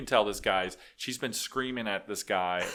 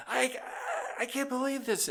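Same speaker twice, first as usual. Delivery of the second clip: much higher mock exasperation; imitating the wife. Mocking the wife's outrage, much higher voice. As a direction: wail it like the indignant wife. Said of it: "She's like".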